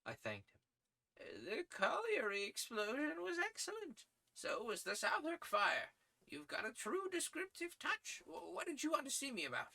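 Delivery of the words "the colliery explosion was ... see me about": put-on high, thin voice of a kindly old editor. Editor praising young Malone's articles, high old-man voice. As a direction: pitch him up as a fussy old newsman.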